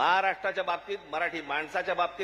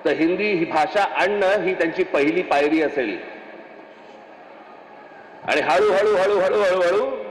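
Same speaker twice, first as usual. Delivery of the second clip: loud, driving political oratory; fiery rally speech; 6 dB louder than usual. Acted. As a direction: rouse the crowd from the stage. Rally speech at full force.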